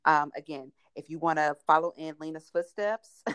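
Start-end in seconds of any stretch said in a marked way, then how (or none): none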